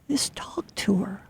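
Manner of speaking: soft whisper, almost breathless. Real, not acted. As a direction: hush it like a gentle secret. Gentle advice about missing grandma.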